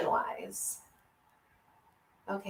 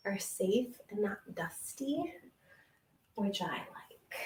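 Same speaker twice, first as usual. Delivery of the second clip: whisper; 9 dB quieter than usual. Real, not acted. Confiding aside to camera.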